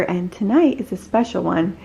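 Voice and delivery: ordinary delivery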